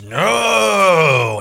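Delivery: a possessed man growling out a loud refusal; growly yell. Retold or imitated. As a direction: bark it out like something possessed. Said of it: "he yells out with a growly voice".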